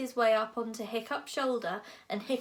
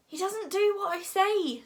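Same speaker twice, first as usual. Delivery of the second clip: high, loud helpless complaint; flustered protest; 10 semitones higher, 5 dB louder than usual. A narrator's reading. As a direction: protest helplessly over the chaos. Hiccup protests in the chaos.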